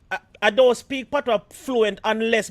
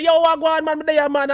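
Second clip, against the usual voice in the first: lively patois reply; 7 semitones higher, 5 dB louder than usual. Retold or imitated. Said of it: "me say".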